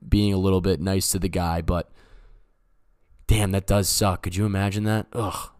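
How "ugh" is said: ordinary talk, nothing marked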